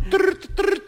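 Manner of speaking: high trilled phone ring; playful trilled ringtone; imitated phone ring. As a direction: roll the tongue like a ringing telephone. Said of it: "Εγώ θα κάνω τρρρ".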